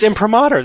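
imprimatur as im-pruh-MAH-ter; correct imprimatur. Shown as a demonstration. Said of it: "That's actually correct".

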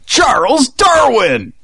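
loud, high, drawn-out pitchman yell; infomercial yell. Pretend. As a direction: sell it like an infomercial host. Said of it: "kind of a yell".

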